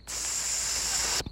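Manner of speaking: long soft hissing noise; weird hiss. Acted. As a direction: hiss quietly and evenly, like static. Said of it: "making a weird noise".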